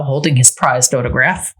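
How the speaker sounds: plain reading voice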